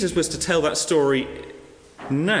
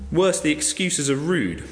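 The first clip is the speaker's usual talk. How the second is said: usual speaking voice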